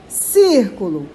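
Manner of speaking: "Círculo" slow, leaning on "Cír"; stress on first syllable. Shown as a demonstration. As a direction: stretch the "Cír" in "Círculo", then let it fall. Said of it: "sílaba tônica, o cir".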